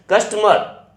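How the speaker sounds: कस्टमर said high, the final र nearly silent; कस्टमर with the last र swallowed; model pronunciation. Shown as a demonstration. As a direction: say कस्टमर as "kustma", dropping the र. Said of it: "इसका pronunciation होगा कस्टमर".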